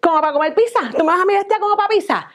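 high indignant huff; flouncing off in a sulk; high-pitched indignant refusal and dismissal; huffy girlfriend. Acted. Girlfriend storming off.

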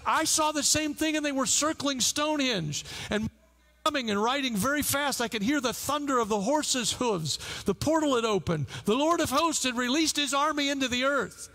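rousing sermon climax; impassioned preaching. Retold or imitated. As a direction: proclaim the vision to the congregation. Preacher builds to a climax recounting vision.

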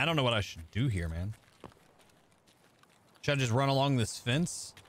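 ordinary talk

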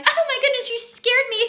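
high, airy startled voice; mock sweet fright. Wife imitating her newlywed self startled by sneeze, high and sweet. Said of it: "where I was like".

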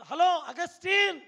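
loud, slow call across the street. Retold or imitated. Mother calling her son across the road, loud and drawn out. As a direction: call his name from a distance, drawn out.

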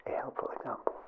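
ordinary delivery

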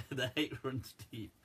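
quiet laughing repeat. Real, not acted.

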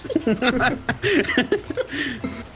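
long high laughing fit. Real, not acted. Lacht über Fehlschuss. (langes, hohes Lachen)

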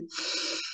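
long, audible sigh; deep breath out. Shown as a demonstration. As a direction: demonstrate a slow, deep breath.

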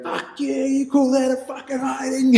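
high shouted jeer from a car window; taunting shout. Retold or imitated. Hanging out the car window he shouts high taunts at attackers. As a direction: holler the taunt high and cocky. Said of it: "I'm hanging out the window".

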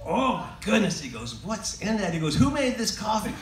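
a retold gasp of disgust; mock shocked coffee drinker. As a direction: act out spitting out spiked coffee.

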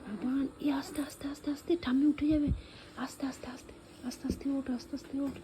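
soft, unvoiced hush to a child; hushed whisper. Father hushing his son so grandma stays asleep, whispering. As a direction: whisper so grandma keeps sleeping.

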